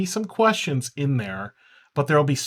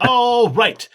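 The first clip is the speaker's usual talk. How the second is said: announcer call; 8 semitones higher, 8 dB louder than usual. Acted. Announcer voice kept going.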